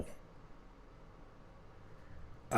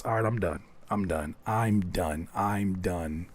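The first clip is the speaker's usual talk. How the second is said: trailing off; 8 semitones lower, 7 dB quieter than usual. Real, not acted. Fading out while laughing.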